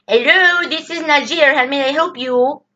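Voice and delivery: high, put-on Indian call-center accent. Mocking Indian call center agent.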